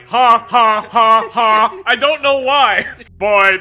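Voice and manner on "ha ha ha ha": loud mechanical ha ha; robot laugh. Retold or imitated. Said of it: "They keep going".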